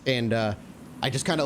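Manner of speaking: normal talking voice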